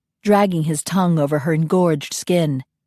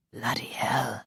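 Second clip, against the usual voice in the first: "Bloody hell" as a soft, voiceless whisper; whispered curse; 6 semitones lower, 11 dB quieter than usual. A narrator's reading. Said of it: "he whispered".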